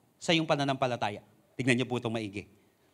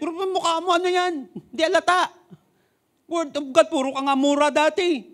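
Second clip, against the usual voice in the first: much higher sneering voice; high mocking scoff; 8 semitones higher than usual. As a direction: belittle him with a scoff. (mataas na boses ng panunuya)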